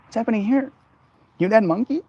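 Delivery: a little lizard squeaking up at the big monkey; squeaky lizard voice. Acted. Lizard asks the streamer if they're the monkey, in a high squeaky voice. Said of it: "that lizard comes up and goes".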